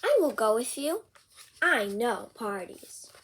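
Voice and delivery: lively picture-book read-aloud; bouncy storybook reading; reading to children. Acted. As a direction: read it out with big character voices for a kid.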